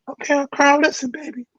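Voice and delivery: very high-pitched old-bandmate impression; Frank West. Saxophonist addresses his new drummer, high-pitched imitation. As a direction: put on a squeaky-high voice, far above your own. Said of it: "Frank used to talk in this high-pitched voice".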